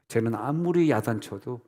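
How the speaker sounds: ordinary delivery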